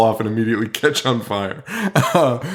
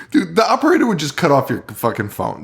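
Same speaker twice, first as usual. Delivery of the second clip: ordinary talk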